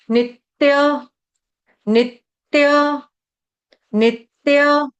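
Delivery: नित्य chanted as nit-tya with a held break on त; slow नित्य with doubled त; double t. Shown as a demonstration. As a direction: stop on the first त of नित्य, then release tya. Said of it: "double त".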